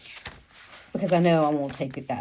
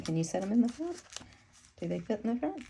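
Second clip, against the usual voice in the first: much higher, quieter puzzled question; 8 semitones higher, 4 dB quieter, faster than usual. Real, not acted. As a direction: ask yourself, pitched way up. Testing the insert, asking herself aloud.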